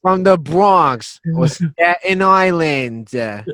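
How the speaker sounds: loud, slow, high mock New York accent; mocking rough New York accents, New York accent. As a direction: ham up a tough New York local. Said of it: "rough New York accents like that".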